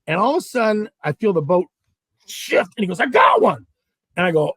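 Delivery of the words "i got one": high, louder burst of surprise; excited imitation. Partner calls out a sudden bite, excited. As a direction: imitate an excited catch call.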